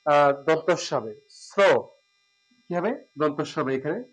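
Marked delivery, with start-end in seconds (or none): none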